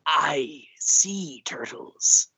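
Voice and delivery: hushed, drawn-out pirate whisper. Acted. Podcast guest going all in on pirates, whispered pirate impression. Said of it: "You asked for the pirates".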